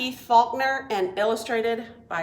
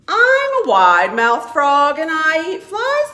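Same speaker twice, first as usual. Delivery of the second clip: loud, boastful frog voice, much louder; proud frog; 5 semitones higher, 10 dB louder than usual. Acted. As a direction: announce it like a showy frog. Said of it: "said the wide-mouthed frog".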